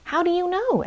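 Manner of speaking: put-on high, small child-like voice; tiny young chimp's question; little chimpanzee. A narrator's reading. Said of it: "asked one little chimpanzee".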